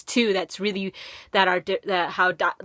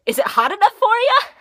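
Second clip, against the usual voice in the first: high-pitched mock questioner; high, teasing impression of a stranger; imitated stranger's question; 12 semitones higher, 4 dB louder than usual. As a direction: imitate a passer-by asking, pitched way up. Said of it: "and she said".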